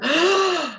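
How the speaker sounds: sharp high gasp for air; demo gasp. Shown as a demonstration. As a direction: suck in a quick startled breath. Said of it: "You have to".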